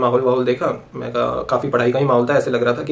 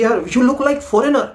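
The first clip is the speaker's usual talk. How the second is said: South Indian guy; 9 semitones higher, much slower than usual. Retold or imitated. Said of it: "तो कहता है कि".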